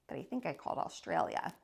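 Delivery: fast, unvoiced guilty confession; sheepish whisper. Boy admitting he phoned Australia, guilty whisper. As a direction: whisper it like a naughty secret.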